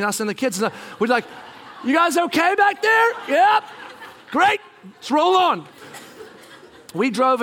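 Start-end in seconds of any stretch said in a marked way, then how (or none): 1.8-5.6 high, raised call to the back; bright-pitched shouted check-in. Acted. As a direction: holler to kids behind a wall of luggage. Calling over wall of luggage.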